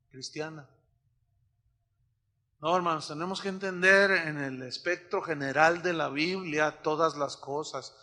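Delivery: normal talking voice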